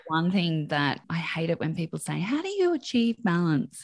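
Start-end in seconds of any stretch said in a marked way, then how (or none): 2.2-3.8 higher-pitched mimicry of a naive questioner; mock earnest question. Imitating people who ask about balance, mock earnest. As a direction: parrot the question in a raised voice.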